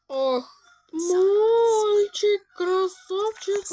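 high, loud cooing over a cute animal; excited cooing. Real, not acted. Player coos at a cute animal in the game, excited. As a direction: gush like at a puppy.

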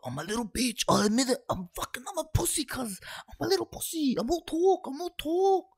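loud, high squealing self-abasement; panicked confession. Real, not acted. Player admits cowardice to viewers, panicked squeal.

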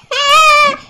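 squeaky high-pitched giggle; a short shrill burst of laughter. Real, not acted. Laughing at sibling.

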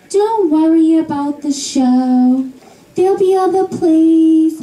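high, singsong soothing mom; mother voice. Retold or imitated. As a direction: coo like a doting mother. Mother consoling her.

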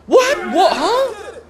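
a sudden, very high, loud "What?"; shocked. Real, not acted. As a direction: yelp it out in disbelief. Shocked by a play in game.

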